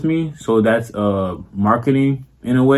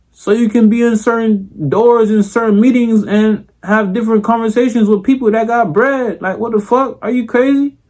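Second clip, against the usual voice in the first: animated; 10 semitones higher, 3 dB louder than usual. Real, not acted.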